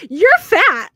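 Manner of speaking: high, loud blurted jab; blurted insult. Retold or imitated. Friend blurting at her in church, loud and high. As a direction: blurt it out gleefully.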